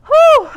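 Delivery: high loud relieved whew. Real, not acted. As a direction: blow out relief after exertion. Out of breath after workout.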